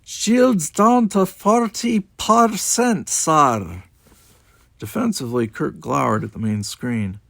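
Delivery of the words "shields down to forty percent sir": very slow, high, loud Scots-on-Russian report; Scottish-Russian accent. A narrator's reading. Chekhov reporting damage to captain, weird Scottish-Russian accent. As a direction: stretch it out in a weird mixed brogue. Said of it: "kept up that weird accent on accent".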